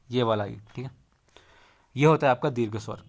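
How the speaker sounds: usual speaking voice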